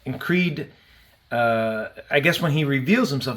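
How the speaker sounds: usual speaking voice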